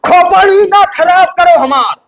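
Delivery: high, drawn-out irritated yell; angry shouting. Acted. Brother-in-law snaps at her demand for a song, shouting. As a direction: yell it slowly, fed up. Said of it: "इतना तेज क्यों चिल्ला रहे हैं".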